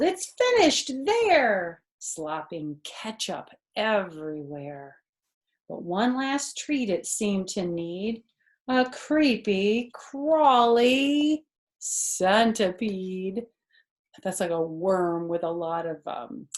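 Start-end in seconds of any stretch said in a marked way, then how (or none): 0.0-1.8 higher, louder slowed call; storybook yell of triumph. A narrator's reading. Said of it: "Samantha yelled". 2.0-13.5 put-on lively read-aloud for a child; animated children's story narration; storybook reading. A narrator's reading. Reading picture book aloud.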